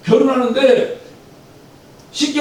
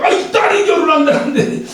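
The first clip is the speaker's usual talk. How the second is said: mock indignant outburst; 6 semitones higher, 3 dB louder than usual. Indignant reaction voiced aloud.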